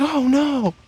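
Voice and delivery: put-on high-pitched girl voice; girl's voice. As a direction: squeak it up like a flustered girl. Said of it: "she's like".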